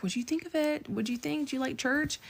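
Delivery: high, soft, tentative questions; retold coaxing in a small high voice; hopeful probing. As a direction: imitate a careful, eager little ask. Retelling hopeful probing after sermon.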